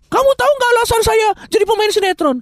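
high, hammed-up dumb actor voice; squeaky mock soap star. Mock actor confession in sinetron.

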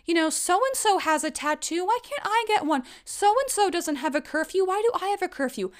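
put-on high, pleading teen whine to mom; whiny teenager. Teenage daughter begging her mom for a tattoo, high whine. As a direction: beg like a sulky teenager. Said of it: "come to my mom as a teenager and say".

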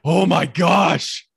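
drawn-out, loud "oh my gosh" on waking up; mock waking exclamation. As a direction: groan it out slowly like you just woke up overheated. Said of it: "Waking up going".

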